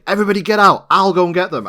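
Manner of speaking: high-pitched mock-heroic call; urgent calling out. Imitating Mulder clearing a burning house, urgent calling out. Mulder takes charge in the fire.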